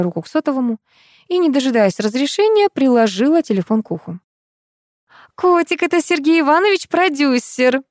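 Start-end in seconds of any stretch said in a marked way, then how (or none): 5.3-7.8 put-on high, coy girl on the phone; giggly girlish voice. A narrator's reading. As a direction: tease sweetly in a lightly girlish pitch. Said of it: "захихикал девичий голосок". (игривый девичий голосок)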